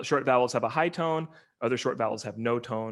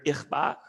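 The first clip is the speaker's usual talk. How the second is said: ich ba, stress on the final BA, no tone; stress on final syllable. Shown as a demonstration. Lecturer shows a toneless word, final stress on ba. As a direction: put the weight on "ba" in "ich ba". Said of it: "Stress is final".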